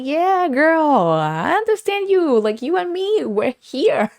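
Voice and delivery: high, drawn-out squeal of solidarity; hammed-up, high-pitched girlfriend enthusiasm; gushing reply. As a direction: gush it high and stretched out. Acting out her gushing reply.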